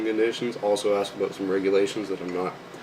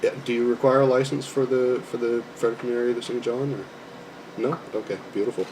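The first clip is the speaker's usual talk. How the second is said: polite customer service phone voice; friendly, formal voice for a call; 3 dB quieter than usual. Real, not acted. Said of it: "You guys got to hear my phone voice".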